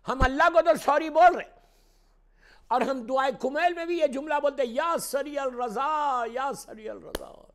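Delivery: high, shouted sermon delivery; loud pulpit voice, drawing out the invocation; impassioned preaching. Real, not acted. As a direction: cry out to the whole congregation. Preaches at full force.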